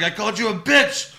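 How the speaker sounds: high-pitched imitated taunt; mock defiance. Host retells Owens taunting his opponent, mock defiance. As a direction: fling it back in mock fury. Retelling wrestlers' trash talk.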